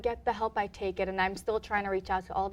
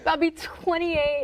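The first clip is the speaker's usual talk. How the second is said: slow, higher laughing repeat; 7 semitones higher, 5 dB louder, much slower than usual. Real, not acted. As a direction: stretch it out through a giggle. Guest laughs, repeats her age.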